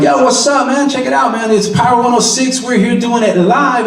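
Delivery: high-pitched, casual hype on air; modern radio DJ. Retold or imitated. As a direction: imitate an upbeat street-style radio host. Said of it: "everybody's just talking like".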